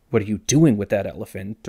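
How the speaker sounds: accent lands on DOING, rising at the end; stress on DOING. Shown as a demonstration. Comparing six questions for viewers, stress on DOING. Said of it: "where you place the accent".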